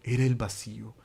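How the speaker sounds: usual speaking voice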